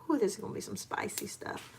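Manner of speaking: whispered aside about spicy food; hushed remark while chopping. Real, not acted. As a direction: whisper it under your breath.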